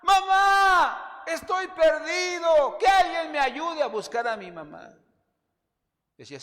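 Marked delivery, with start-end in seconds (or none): 0.0-4.9 loud high yell for mama; a lost child screaming for his mother; shouted cry for help. Shown as a demonstration. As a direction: scream it as loud as you can. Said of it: "grita, 'Mamá".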